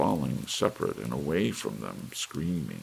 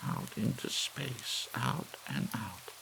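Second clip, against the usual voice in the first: hushed wonder; 9 semitones higher, 6 dB quieter, slower than usual. A narrator's reading. Missus Bellows thinks.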